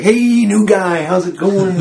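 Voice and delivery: high, slow stoner drawl; lazy friendly stoner greeting. Retold or imitated. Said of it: "she kinda talked like a stoner".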